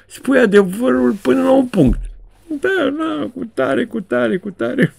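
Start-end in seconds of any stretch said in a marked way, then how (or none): 2.6-4.9 mock CIA director. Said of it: "directorul C I A în fiecare an zice".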